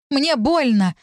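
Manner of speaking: high pained cry, moderate volume; cried out. A narrator's reading. Heroine with arms twisted behind her, crying out. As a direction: let pain push the pitch up. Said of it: "крикнула я".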